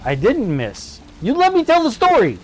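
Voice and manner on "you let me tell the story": much higher, playful huff; mock indignant. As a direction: pretend offended storyteller. Teasing friend for interrupting.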